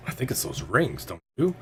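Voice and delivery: low, soft put-on voice, lifting at the end; hushed low aside with rising tag question; confiding onlooker. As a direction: confide a suspicion quietly, in character. The other guy confides a suspicion.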